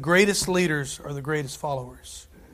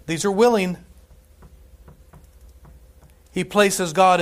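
louder, rising preacher's voice; preaching; 3 semitones higher, 5 dB louder than usual. Acted. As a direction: preach it with growing force. Preaching builds to full force.

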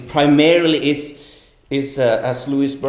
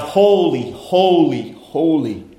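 angels crying out; 3 semitones higher, 4 dB louder, slower than usual. Acted. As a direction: call it out like heaven's choir. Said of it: "the seraphim crying out".

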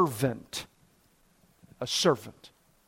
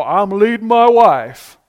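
mock macho boast, louder and slower; chest-beating husband; 5 dB louder, slower than usual. Preacher mimicking husbands who beat their chest, mock boastful. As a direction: puff it up like a domineering husband. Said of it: "who will beat their chest".

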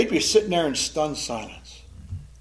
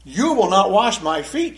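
louder, slow, each word of "You will not wash my feet" pressed; emphatic refusal; 4 semitones higher, 5 dB louder than usual. Acted. Peter refusing Jesus, emphatic and louder. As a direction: refuse him, firm and deliberate. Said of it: "It's emphatic".

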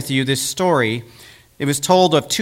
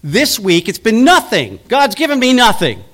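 rising, louder, higher whining complaint; hammed-up self-pitying grumble, higher and louder; mock complaint; 6 semitones higher, 4 dB louder than usual. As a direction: build to an aggrieved high wail. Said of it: "He said".

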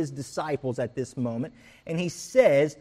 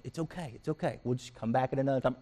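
soothing wife; 7 dB quieter, faster than usual. Retold or imitated. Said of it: "Sarah's like".

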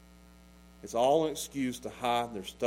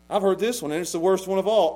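raised, urgent sermon voice; fiery preaching; 7 semitones higher, 8 dB louder than usual. Acted. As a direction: preach it hard at the congregation. Preaching at full force.

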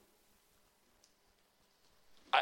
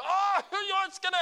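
mock panic; 12 semitones higher, 4 dB louder, faster than usual. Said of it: "and you".

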